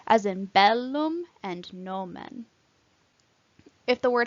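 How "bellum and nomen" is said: "bellum" and "nomen" slowly, stressed on BEL and NO; first-syllable stress. Shown as a demonstration. Teacher showing two-syllable words, "bellum" and "nomen" stressed first. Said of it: "the first syllable is stressed".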